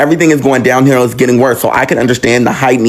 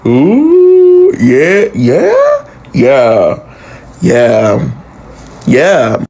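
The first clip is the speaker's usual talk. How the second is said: caught off guard; much slower than usual. Retold or imitated. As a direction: stall like you've been caught. Caught in a lie, stalling.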